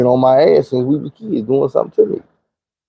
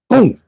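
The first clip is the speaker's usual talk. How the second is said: much higher, sharp boom; 10 semitones higher than usual. Retold or imitated. Telling how sister's hit landed, a high boom. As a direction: pop the boom out like an impact. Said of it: "she hit me like".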